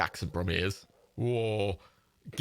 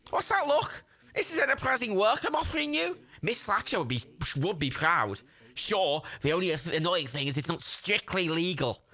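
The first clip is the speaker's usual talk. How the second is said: lively put-on Scouse accent, often pitched up; Scouse Jimmy; 4 semitones higher than usual. Chancer pitching a shady stripping job, put-on Scouse accent. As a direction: play a wheeler-dealer in Scouse. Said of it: "I said I'd do the Scouse accent".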